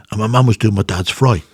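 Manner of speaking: ordinary delivery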